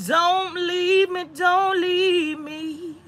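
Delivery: high, very drawn-out whine; slow mock-tearful wail; mock begging. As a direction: stretch it into a pitiful, hammed-up plea. Mock begging kids not to leave.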